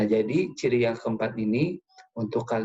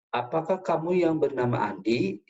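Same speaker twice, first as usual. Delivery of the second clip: higher-pitched question ending upward on "Andi"; rising question intonation; 5 semitones higher than usual. Shown as a demonstration. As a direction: voice lifts high on "Andi". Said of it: "intonasinya meninggi pada kata Andi".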